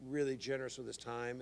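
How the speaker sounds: normal talking voice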